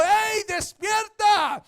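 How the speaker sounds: slow, loud alarmed shout; shouted wake-up. Shown as a demonstration. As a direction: yell to wake someone in a fire. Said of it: "usted va a alzar la voz". (grito alarmado y lento)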